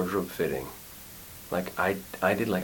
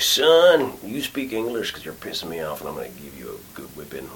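acted drunk belligerent Southern father; 2 semitones lower, 4 dB louder than usual. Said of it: "To be, like, belligerent".

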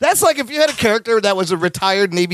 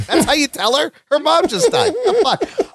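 incredulous outrage; 9 semitones higher than usual. Retold or imitated. Viewer objecting to how she was told, appalled. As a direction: pitch climbing into comic disbelief. Retelling outrage at callous line.

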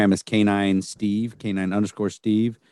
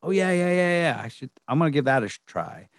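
eager; 8 semitones higher, faster than usual. Retold or imitated. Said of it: "and go".

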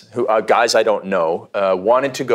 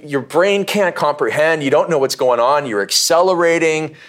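ordinary talk, nothing marked

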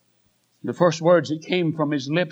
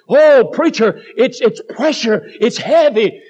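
higher, louder burst of a strained plea; distressed wife; 4 semitones higher, 6 dB louder, slower than usual. Acted. Preacher voicing the young pastor's wife, strained plea. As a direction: plead as if the weight is crushing.

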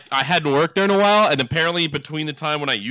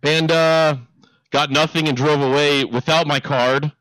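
usual speaking voice

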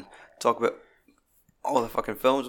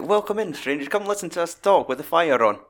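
higher, hammed-up old innkeeper welcome; cosy fireside host; 6 semitones higher, 4 dB louder than usual. Welcoming listeners to the Yule fire, hammed-up innkeeper voice. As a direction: greet them like an innkeeper by the fire.

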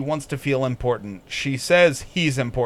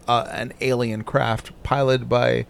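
ordinary talk

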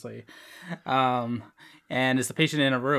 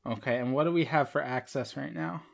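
ordinary talk

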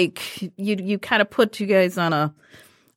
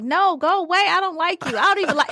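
higher, fed-up brush-off; mock annoyed; 8 semitones higher, faster than usual. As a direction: shoo him away, exasperated. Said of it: "retorting to hugging student".

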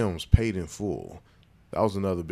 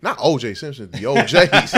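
laughing; 5 semitones higher, 8 dB louder than usual. Real, not acted. Laughing correction.

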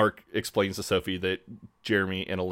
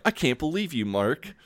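high indignant woman voice; 7 semitones higher than usual. Retold or imitated. Sophie indignant.

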